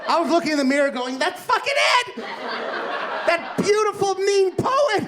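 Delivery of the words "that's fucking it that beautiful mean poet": much higher, cracking with laughter; laughing, stretched-out eureka shout; ecstatic shout. Retold or imitated. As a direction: yell it like a sudden revelation. Mirror epiphany, shouting at self.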